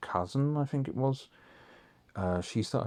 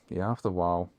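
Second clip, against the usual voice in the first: normal talking voice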